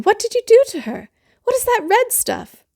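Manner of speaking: high, loud upset mother voice; a mother horrified at the mess; shrill alarmed mother. Acted. Missus Lane alarmed.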